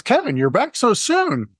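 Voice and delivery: high, delighted welcome back; excited barista. Retold or imitated. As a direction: imitate a thrilled barista greeting a customer. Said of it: "to be that excited".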